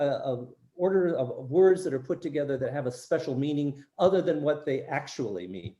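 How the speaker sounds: normal talking voice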